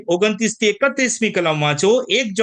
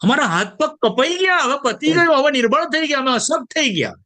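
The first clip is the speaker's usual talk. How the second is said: higher, pleading lament of giving up; despairing people; 7 semitones higher than usual. Retold or imitated. Preacher voices hopeless believers giving up. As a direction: voice the broken ones who say it's over. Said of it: "એટલે મેં શું થયું?".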